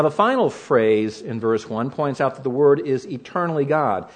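ordinary delivery

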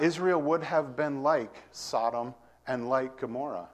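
ordinary talk, nothing marked

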